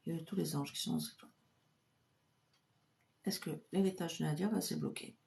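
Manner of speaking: a soft, reverent murmur calling on the angels; hushed invocation. Acted. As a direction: pray quietly to the guardian angel over the pendulum. Invokes angels, asks the pendulum.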